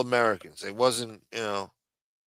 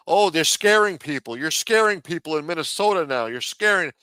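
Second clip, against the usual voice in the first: raised-pitch mocking complaint; mock alarm; 6 semitones higher, 5 dB louder than usual. Mimicking critics who object to questions, mock alarm.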